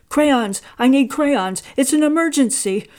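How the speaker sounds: loud, high, breathless demand; urgent shout. A narrator's reading. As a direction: burst in and demand crayons now. Carl bursts into store urgently.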